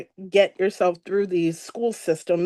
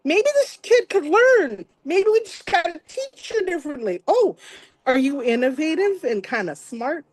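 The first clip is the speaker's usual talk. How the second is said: lightly voiced eager teacher, raised higher; a hint of an excited teacher discovering a student; mild voicing of a hopeful teacher; teacher's voice; 6 semitones higher than usual. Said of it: "she was like".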